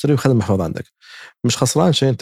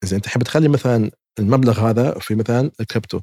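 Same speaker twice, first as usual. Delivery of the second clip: usual speaking voice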